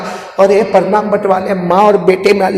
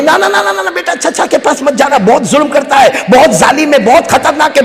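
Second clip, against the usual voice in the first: a mother begging her son to stay away; frightened pleading mother; 7 semitones higher than usual. Acted. Mother pleading with her son not to visit the uncle, high and frightened.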